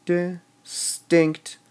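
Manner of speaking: Distinctly slowly split as di, STINCT, ly; Distinctly, measured out with pauses; syllable by syllable. Shown as a demonstration. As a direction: break Distinctly into three separate beats. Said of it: "We emphasize the distinct part".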